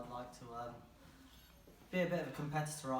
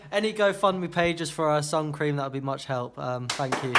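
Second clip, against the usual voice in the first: mock plea; 13 dB louder, slower than usual. Mock appeal for sun cream.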